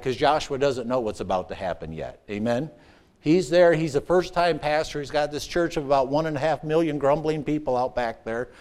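ordinary talk

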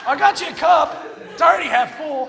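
loud, high joking shout through laughter; laughing shout. Real, not acted. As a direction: yell the joke, cracking up.

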